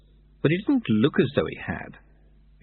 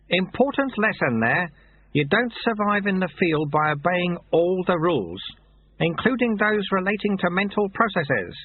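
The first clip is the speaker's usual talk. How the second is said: put-on higher, chatty old monk voice; genial old sweeper; 8 semitones higher than usual. A narrator's reading. Old sweeper chats to the novice about Soto, genial. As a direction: amiable old man rambling fondly.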